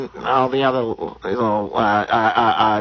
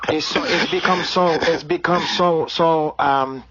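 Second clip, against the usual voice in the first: put-on Brazilian accent; 3 semitones higher than usual. Comedian holding a put-on Brazilian accent.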